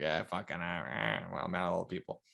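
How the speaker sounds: a hint of a grumpy old puppet; lightly voiced cranky old guy; grumpy old man. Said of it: "Jeff Dunham with his angry, angry old guy".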